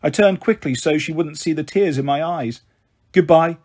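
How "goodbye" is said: ordinary delivery, nothing marked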